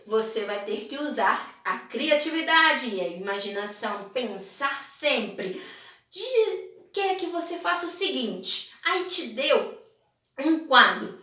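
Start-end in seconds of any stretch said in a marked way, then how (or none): none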